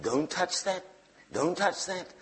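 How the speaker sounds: soft, cautious imitated thought; inner warning voice. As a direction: warn yourself under your voice. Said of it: "part of my brain is going".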